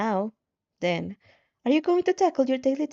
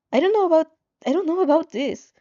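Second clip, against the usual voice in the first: higher, louder anxious protest; nervous, louder and higher, repeating himself; anxious outburst; 6 semitones higher, 5 dB louder than usual. Acted. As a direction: blurt out the doubt in rising panic. Anxious refusal at scary prospect.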